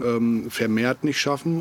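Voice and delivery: ordinary talk, nothing marked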